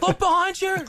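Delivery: high, loud yell through laughter; mock screaming warning. Imitating viewers screaming a warning into the mic, laughing yell. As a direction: shout it high, cracking up. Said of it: "they're screaming into the mic".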